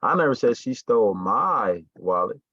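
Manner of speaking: "my" drawn out and punched; stress on MY. Shown as a demonstration. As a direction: hit the word "my", slowly. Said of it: "She didn't steal mine".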